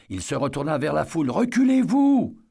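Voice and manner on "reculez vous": much higher and louder, drawn out; alarmed shout to clear the way; commanding yell. Acted. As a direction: order the crowd back at full voice. Eddie orders the crowd back.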